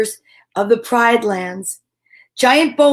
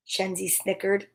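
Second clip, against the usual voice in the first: ordinary delivery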